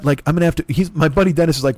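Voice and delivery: normal talking voice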